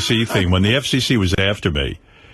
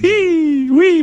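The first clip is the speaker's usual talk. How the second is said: siren wail; 5 dB louder than usual. Acted. Mock siren.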